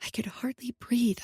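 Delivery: ordinary delivery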